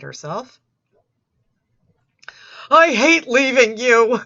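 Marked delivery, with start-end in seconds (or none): 2.7-4.2 louder, mock tragic wail; hammed-up loud lament. Reading his goodbye message, loud mock anguish. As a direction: overplay the sorrow of parting.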